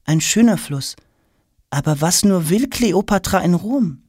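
put-on high, bright-eyed boyish questioning; a curious schoolboy firing questions; eager boy Tom. Schoolboy Tom pestering the silent queen with questions.

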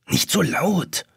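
put-on boy afraid the aunt and uncle wake; horrified hiss. A narrator's reading. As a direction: hush it in alarm. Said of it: "zischt Jonas entsetzt".

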